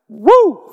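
high, loud joyful whoop; exultant shout woo. Real, not acted. Preacher whooping at the congregation in joy. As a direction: let out a triumphant whoop.

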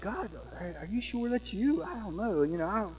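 small, unsure mock-nervous murmur; hesitant doubt. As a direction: timidly question what you were just told. Voicing woman hesitantly questioning God.

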